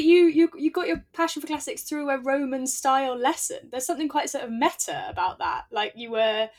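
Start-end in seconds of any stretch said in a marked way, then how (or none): none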